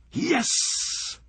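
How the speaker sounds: high, breathy whispered cheer; a touchdown celebration under the breath; triumphant yes. Acted. Signals touchdown with arms.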